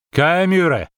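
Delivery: high, loud foreign cry; exclaiming. A narrator's reading. Dryad reaching for an arrow, crying out. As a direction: call out the curse high and strong.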